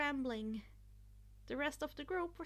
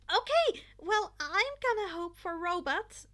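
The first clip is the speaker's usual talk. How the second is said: a loud huffy complaint, pitched up; 5 semitones higher, 10 dB louder than usual. A narrator's reading. Mina complains to friends, loud and huffy. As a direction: huff it out, half joking. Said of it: "Mina huffed".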